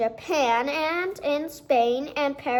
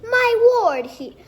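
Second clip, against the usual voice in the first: high, loud exclamation of amazement; astonished declaration; 7 semitones higher, 6 dB louder than usual. A narrator's reading. Principal sees the daisy, exclaiming high and loud. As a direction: gasp it out in wonder. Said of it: "he declared".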